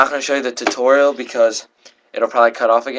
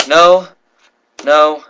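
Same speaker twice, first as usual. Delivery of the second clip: insistent no; 3 semitones higher, 6 dB louder than usual. Retold or imitated. Girls correct the wrong address.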